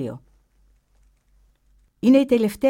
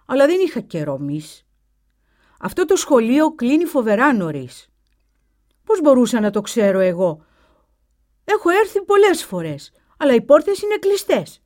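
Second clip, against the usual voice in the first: put-on higher, louder boy's voice; an indignant boy protesting; voiced boy; 5 semitones higher, 7 dB louder than usual. A narrator's reading.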